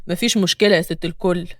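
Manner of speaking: low-pitched man's voice; ticket collector. A narrator's reading. Ticket collector accepts the lady's payment, low man's voice. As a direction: drop well down for the ticket man.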